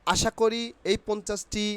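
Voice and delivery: ordinary talk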